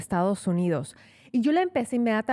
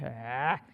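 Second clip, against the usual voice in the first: gargoyle grunt; 8 semitones lower, 6 dB quieter than usual. Retold or imitated. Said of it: "volteó así de".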